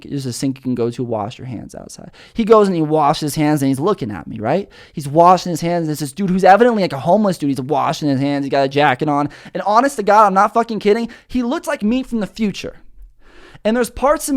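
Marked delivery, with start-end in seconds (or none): none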